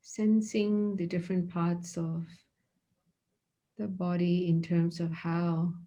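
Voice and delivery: soft, unhurried meditation voice with long pauses; guided meditation. Acted. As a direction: guide them quietly into the body scan. Leads a body scan meditation.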